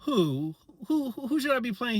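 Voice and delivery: confused. Retold or imitated. Teacher confused by student.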